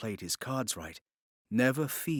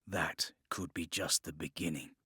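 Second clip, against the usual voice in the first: a conspiratorial whisper; whispered hint of more to come; 7 semitones lower, 8 dB quieter than usual. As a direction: breathe it like a secret offer. Conspiratorial line.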